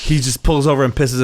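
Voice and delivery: ordinary delivery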